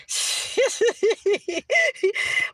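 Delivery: high giggle; real laughter. Real, not acted. Streamer tickled by staying home like kids, real giggling.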